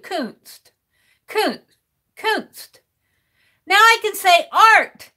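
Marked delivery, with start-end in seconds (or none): 0.0-2.8 Kunst with a long oo vowel, repeated. Shown as a demonstration. Streamer drills the word after chat's correction. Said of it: "A long U".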